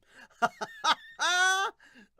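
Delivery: loud, high burst of laughter; big laugh. Real, not acted. Laughing at a viewer's comment about the manga, big high laugh.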